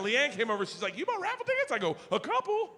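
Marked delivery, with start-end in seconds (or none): 1.0-1.7 imitating her, very high; much higher, wife-like query; high-pitched mimicked question. Voices Leanne's question. 2.1-2.7 squeaky, very high reply; mock-innocent high voice; high coy answer. Coy answer in acted dialogue.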